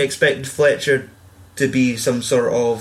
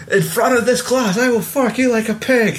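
high, strained imitation of a raging teacher; hammed-up high threat, close to laughing; mock furious threat; 10 semitones higher than usual. Retelling the teacher's threat to a friend, high mock rage.

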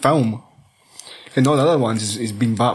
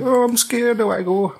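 put-on much higher, whimpering child voice; scared wee Bruce; 10 semitones higher than usual. Mocking wee Bruce at the opera, high and frightened. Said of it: "wee Bruce is like".

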